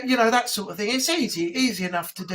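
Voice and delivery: usual speaking voice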